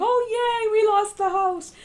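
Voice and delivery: high, loud, sarcastic fake joy; mock cheer. Mocking what they don't say about losing the House, sarcastic fake joy. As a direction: ham up a fake celebration. Mocking imagined reaction to loss.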